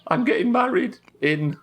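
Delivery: mock wedding invitation.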